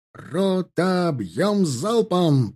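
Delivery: high, very drawn-out volley order; shouted command. A narrator's reading. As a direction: stretch each word out to the whole company. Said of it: "прокричал егерский капитан". (высокий протяжный командный крик)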